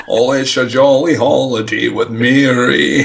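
slow, slurred-S Scottish film star impression; mock movie-star Scot doing a musical; Sean Connery. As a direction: croon it slowly as a hammy Scottish leading man. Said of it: "Sean Connery as Mary Poppins".

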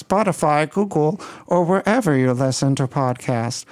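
lightly mock computer voice, stiff and even; a hint of a robot reading the sign-off; robotic voice. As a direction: stay in a mild synthesized-voice bit for the outro. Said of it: "like Stephen Hawking speaking French".

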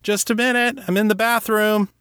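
high-pitched call through a door; shouted. A narrator's reading. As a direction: call out to someone in another room. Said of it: "He turned toward the door and shouted".

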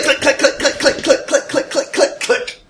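high clicking gun noises; gun clicks. Shown as a demonstration. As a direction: imitate a trigger clicking. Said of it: "pull out my gun and start, like".

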